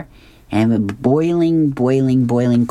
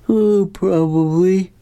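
slow, higher-pitched drowsy drawl; sleepy husband; 7 semitones higher, slower than usual. A narrator's reading. Husband still in bed answering his wife, sleepy. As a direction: stretch it out as if half asleep. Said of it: "he's still asleep".

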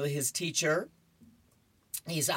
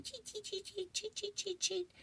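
very high, soft bird chirps; little bird chirping; 11 dB quieter than usual. Acted. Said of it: "he's going".

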